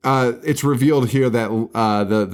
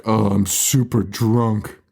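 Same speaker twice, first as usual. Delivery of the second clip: put-on slow, lightly slurred drunk voice; a hint of a tipsy girl confessing; mock drunk girl; slower than usual. Drunk girl behind the wheel, slow mock drunk confession. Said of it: "the drunk girl is driving the car and openly is like".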